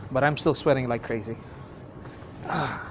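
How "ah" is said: ordinary delivery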